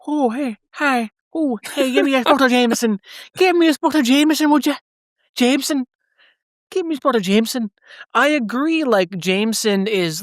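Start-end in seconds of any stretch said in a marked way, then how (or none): 0.0-7.7 hammed-up Irish accent, gums and all, begging a drink; toothless old Irishman. Asked to do it again, host hams up a toothless old Irishman's Irish accent. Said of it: "old Irishman who's lost his teeth".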